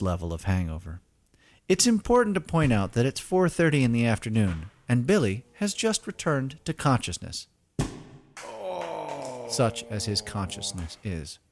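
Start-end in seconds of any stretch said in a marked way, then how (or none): none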